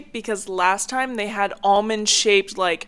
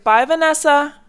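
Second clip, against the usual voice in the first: cheery goodbye; 6 semitones higher, 6 dB louder than usual. Retold or imitated. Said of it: "and I was like".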